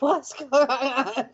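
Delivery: high laugh, slow and flustered; flustered real laugh. Real, not acted. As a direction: giggle through the question. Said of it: "my face is red".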